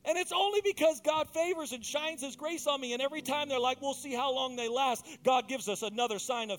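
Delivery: fervent preaching swell; full-force preaching. Acted.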